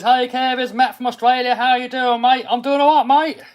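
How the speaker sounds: high, loud Australian mate-greeting; Australian accent. Acted. Streamer reads a viewer's greeting from Australia, mock Australian accent. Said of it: "Excuse my Australian accent".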